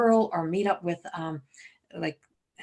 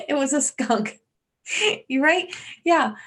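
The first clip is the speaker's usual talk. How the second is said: higher and louder with a laugh; tickled, laughing yes; laughing agreement; 7 semitones higher, 6 dB louder than usual. Real, not acted. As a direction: giggle as you admit the viewer is right.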